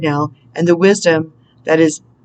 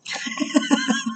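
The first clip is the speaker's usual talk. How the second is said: high laugh; 9 dB quieter than usual. Real, not acted. Laughs at own impression.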